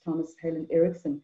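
normal talking voice

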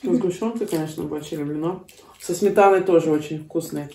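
chewing while talking; speaking around food; mouth full. Real, not acted. Seen 4: Woman chews pancake throughout, cheeks visibly full, bites again at end.

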